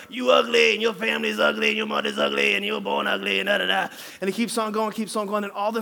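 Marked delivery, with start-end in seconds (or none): 0.1-3.9 lightly voiced parrot yelling insults. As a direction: squawk the insults like a muffled bird. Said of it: "parrot yelling and pecking at the door".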